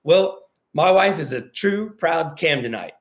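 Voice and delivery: slow, proud stress on true and Camdenite; a slow, proud declaration; proud slow statement. Shown as a demonstration. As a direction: linger on proud Camdenite with pride. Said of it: "I'll say this like this".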